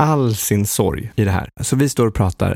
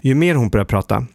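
ordinary talk, nothing marked

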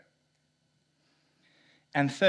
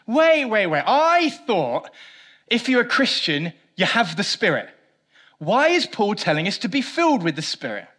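high, loud, startled questioning; mock alarm at a puzzle; puzzled objection; 9 semitones higher, 10 dB louder than usual. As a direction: burst in like a confused listener. Preacher voices a puzzled objector.

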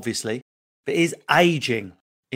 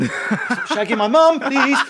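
mock outrage; 11 semitones higher, 5 dB louder, much slower than usual. Mock-outraged aside about mum's boyfriend.

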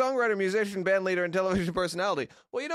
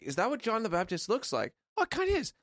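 high musing voice; 8 semitones higher, 5 dB quieter than usual. Retold or imitated. Imagined thoughts watching the joke.

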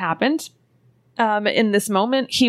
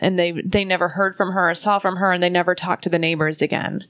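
ordinary delivery

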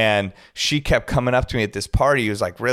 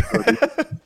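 high laugh; 11 semitones higher than usual. Real, not acted. Laughs at coincidence.

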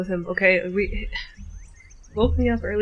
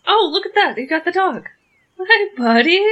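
pet talk; 9 semitones higher, 7 dB louder than usual. Acted. Greeting the dog.

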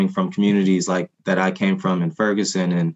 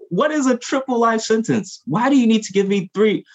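incredulous outrage; 14 semitones higher than usual. Real, not acted. Outraged at triple life sentences.

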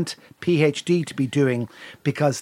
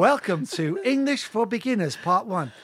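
mock English-course announcer, neatly enunciated; language-tape presenter; 3 semitones higher than usual. Host opens a spoof English for Beginners lesson, mock presenter voice.

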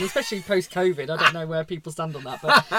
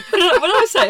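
laughing; 13 semitones higher, 13 dB louder than usual. Real, not acted. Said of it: "laughs through her words".

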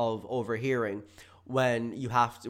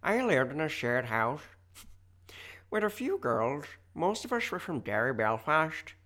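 attempted Northern Irish accent, higher; 4 semitones higher than usual. Reading a listener's message in a mock Northern Irish accent. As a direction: read it in a hammed-up Belfast lilt. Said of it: "read this in a Northern Ireland accent".

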